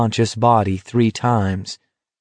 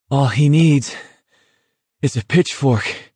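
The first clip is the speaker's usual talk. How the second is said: out of breath, a touch higher; breathless; 5 semitones higher than usual. Acted. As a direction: gasp it out between steps. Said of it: "told Garrity breathlessly".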